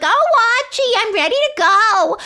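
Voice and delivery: hammed-up singsong holler to a husband; Edith impression. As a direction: call out in a high, piercing comic wife voice. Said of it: "and she's like".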